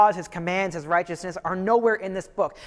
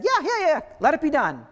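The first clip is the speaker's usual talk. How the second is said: much higher, airy yeah-yeah; careless king; 8 semitones higher than usual. Retold or imitated. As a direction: wave it through without a thought. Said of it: "And the king said".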